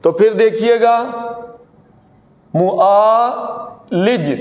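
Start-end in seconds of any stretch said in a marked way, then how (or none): 2.5-4.3 معالج stretched out sound by sound; teacher presenting معالج slowly; very slow model word. Shown as a demonstration. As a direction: drag معالج out for the class. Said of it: "ہم اس کو زیادہ تر یہی پڑھتے ہیں".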